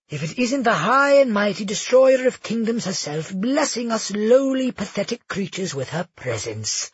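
high, sneering sarcasm; sarcastic mockery. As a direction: lay the mockery on thick. Felix mocks the Empress.

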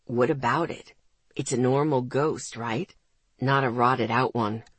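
put-on sceptical teenage brother, low. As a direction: drop into the brother's voice, wary. Ethan voiced by the reader.

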